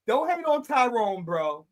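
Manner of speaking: ordinary delivery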